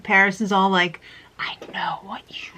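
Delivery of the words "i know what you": mock-menacing whisper, no voice at all; whispered accusation. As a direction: whisper it like you've caught them out. Creepy whispered accusation, repeated callback.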